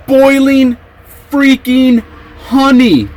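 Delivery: "boiling", "freaking", "honey" each punched high and separately; high, incredulous stress on "boiling freaking honey"; emphatic word-by-word. Real, not acted. Teller stressing the horrifying detail.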